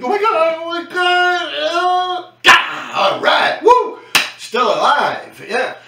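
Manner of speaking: high, loud panicked babble easing into relief; mock panic. Teen with eyes shut as a train passes, panicked then relieved. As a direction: act out pure terror, then a breathless woo of relief. Retelling praying in terror.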